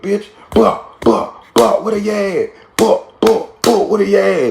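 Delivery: high, garbled half-words between hits; lightly voiced mid-fight hollering; mock yelling while slapping. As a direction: blurt it out like you're swinging at someone. Friend yelling mid-slaps.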